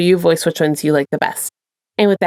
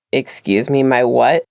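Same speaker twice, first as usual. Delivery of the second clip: lower, gruff disbelieving question; he can't believe what he heard; baffled Dean; 8 semitones lower, slower than usual. Acted.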